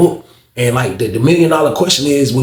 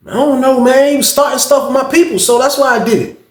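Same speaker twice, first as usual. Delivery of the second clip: Coop's voice pitched higher, shrugging it off; imitating Coop; 10 semitones higher than usual. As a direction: play Coop defending himself in a higher voice. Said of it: "Coop was like".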